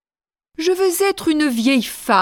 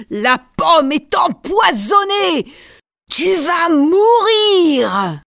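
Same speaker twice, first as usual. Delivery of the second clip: triumphant shout; 3 semitones higher, slower than usual. Acted. Queen gloats over poisoned girl.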